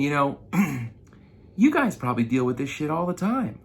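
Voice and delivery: ordinary delivery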